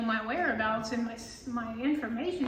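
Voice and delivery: ordinary talk, nothing marked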